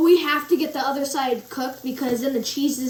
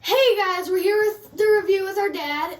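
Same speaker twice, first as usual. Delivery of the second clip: high, loud YouTube host greeting; show intro; 7 semitones higher, 6 dB louder than usual. Acted. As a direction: pump up the opening like a channel host.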